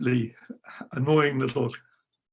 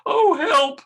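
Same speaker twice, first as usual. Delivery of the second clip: very high, loud yelp for help; panicked cry; 13 semitones higher, 8 dB louder than usual. Retold or imitated. Frightened boy fleeing the wizard, high panicked yelp. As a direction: squeal like a frightened kid.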